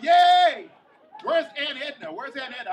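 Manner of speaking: shrill, loud cheer; thrilled at the adults' table; excited yay. Retold or imitated. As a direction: squeal with glee. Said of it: "I'm all excited".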